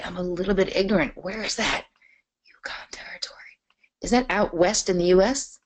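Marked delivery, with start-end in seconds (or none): 2.5-3.4 a puzzled under-the-breath repeat of Yukon Territory; whispering Yukon Territory while trying to place it; whispered repeat. Real, not acted. Repeats place name puzzled to herself.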